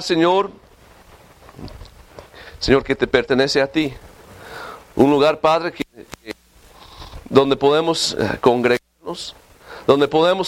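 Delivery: low, hushed pleading prayer to God. Acted. Pastor praying for his congregation, low and humble. Pastor prays aloud before sermon.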